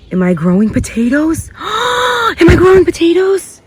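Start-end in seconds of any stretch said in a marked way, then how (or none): 1.5-3.6 gasp, then a very high thrilled question; can't believe your luck; gasping squeal. Real, not acted. Asks again in shock.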